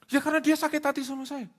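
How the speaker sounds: high, loud mock justification; defensive explanation. Man imitated justifying his apology, high and defensive.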